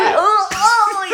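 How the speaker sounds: high, loud, laughing oh oh; mock gasping cries. Retelling the film, mock choking cries. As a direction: ham up choking film characters. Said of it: "ne huutaa".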